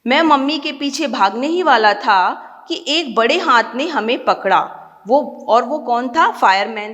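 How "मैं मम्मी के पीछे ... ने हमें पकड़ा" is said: ordinary talk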